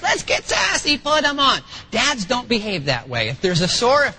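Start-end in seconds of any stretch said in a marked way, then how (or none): 0.0-1.6 high-pitched, cooing mom voice; imitating eager wife. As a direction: mimic a mom hurrying to comfort a child. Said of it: "Diane's quick to the freeze".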